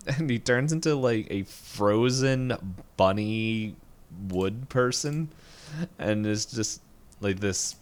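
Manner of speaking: ordinary talk, nothing marked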